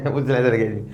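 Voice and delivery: laughing at the next part of the problem. Real, not acted. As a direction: giggle it out fast.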